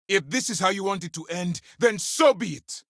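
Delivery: heated, high-pitched defiance; angry ultimatum. Acted. Officer giving up on a peaceful end, angry ultimatum. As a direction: throw it down in anger, pitch way up.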